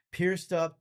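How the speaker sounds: ordinary talk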